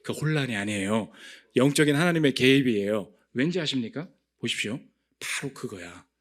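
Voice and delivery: usual speaking voice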